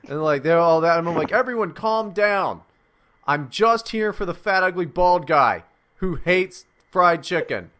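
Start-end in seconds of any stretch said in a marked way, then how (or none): 1.3-7.7 addressing a panicked crowd. Acted. Gunman addressing panicked airport crowd.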